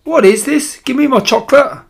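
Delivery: put-on high, loud bratty child; whine-free, pushy demand pitched right up; demanding boy. A narrator's reading. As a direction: spoilt boy losing patience. Boy demanding his chocolate.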